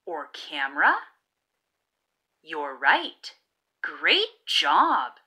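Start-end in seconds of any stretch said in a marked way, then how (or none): none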